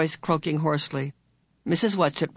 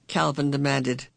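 usual speaking voice

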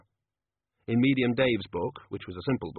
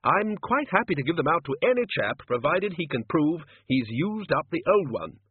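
higher-pitched, hearty head of college; bluff senior wizard; 7 semitones higher, 3 dB louder than usual. Acted. Ridcully explains his pencil rule, higher bluff voice. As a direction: hold forth in a bluff, higher voice.

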